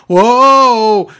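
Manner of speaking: loud, high mock protest; big exaggerated objection; mock alarm. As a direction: call a halt, imitating an alarmed official. Acting the official's alarmed reply.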